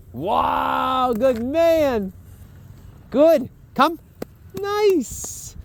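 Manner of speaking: high, bright dog-praise voice. Real, not acted. Trainer praising the dog as it comes back, high and excited.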